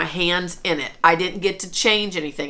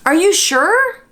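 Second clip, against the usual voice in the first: husband's disbelieving question, pitched much higher; mock his stunned doubt, higher; husband asking in disbelief, pitched higher; imitating husband; 11 semitones higher, 6 dB louder than usual. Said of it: "Famous words".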